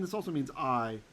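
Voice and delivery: normal talking voice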